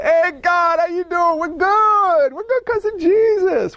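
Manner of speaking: high, breezy buddy voice talking to God; mock a too-chummy believer; casual bro. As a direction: flippant homeboy tone toward God. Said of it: "Knuckle Tap Jesus".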